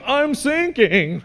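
high, drawn-out mock distress; freaking out. Wakeboarder to the driver as boat stops, mock panic. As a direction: imitate a kid calling out in panic. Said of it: "he's freaking out".

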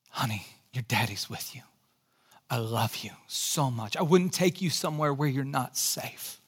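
put-on soft, low comfort to a scared child; tender reassurance. Dad to scared daughter on paddleboard, soft and tender. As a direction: hush her fears gently. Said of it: "And I said".

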